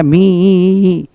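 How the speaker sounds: मी held very long; slow, sustained ee on मी; long ee sound. Shown as a demonstration. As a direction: stretch मी out as "meeee". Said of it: "double ई को छोड़ करके हम बोल रहे हैं".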